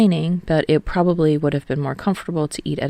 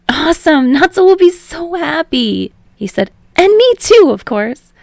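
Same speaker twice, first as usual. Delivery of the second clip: much higher, louder burst of joy; excited Hinata; 11 semitones higher, 8 dB louder than usual. A narrator's reading. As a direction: Hinata thrilled, grinning. Wide grin, excited Hinata.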